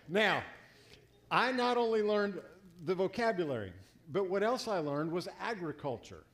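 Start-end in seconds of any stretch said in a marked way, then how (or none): none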